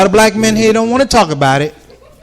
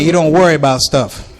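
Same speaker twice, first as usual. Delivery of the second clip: ordinary talk, nothing marked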